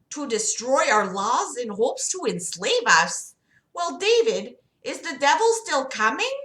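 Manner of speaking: high, singsong doctor voice; Sarah Palin. Acted. Doctor questioning her patient about God, high put-on voice. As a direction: pitch it well up, lilting questions. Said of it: "We'll just do Sarah Palin".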